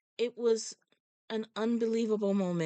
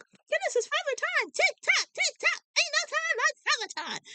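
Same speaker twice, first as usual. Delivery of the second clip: starstruck fans. Retold or imitated. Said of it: "And they're like".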